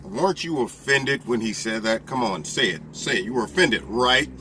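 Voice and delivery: low, mock-coaxing reporter pushing for an answer; mocking media interviewer. Mock reporter coaxing a witness, lightly goading. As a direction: lean in and goad them to say it. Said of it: "they coerce into saying".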